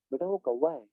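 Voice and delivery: put-on soft gentle answer to a child; quiet, unbothered motherly reply; mother patiently naming the crow again. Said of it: "never she is irritated".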